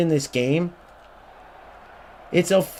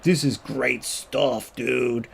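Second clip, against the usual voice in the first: slow, dopey mock-fan voice, softer; mocking dimwit fan; 4 semitones lower, 5 dB quieter, much slower than usual. Mocking a clueless eFootball fan, slow and dopey. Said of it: "eFootball idiots out there that are like".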